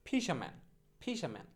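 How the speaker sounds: ফিশাম্যান as "fi-sha-man", no r; corrected pronunciation. Shown as a demonstration. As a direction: drop the r in the middle of ফিশাম্যান. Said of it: "উচ্চারণটা হবে হচ্ছে এরকম".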